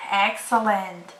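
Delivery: normal talking voice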